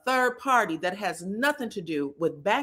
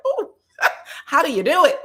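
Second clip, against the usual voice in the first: higher, louder, quick and giggly; gushing mock admiration; 8 semitones higher, 5 dB louder, faster than usual. Host gushing over a singer's voice, giddy and laughing. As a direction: swoon over a voice you love, laughing. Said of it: "I'm always like".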